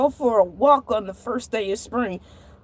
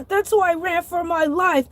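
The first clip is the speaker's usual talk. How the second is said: usual speaking voice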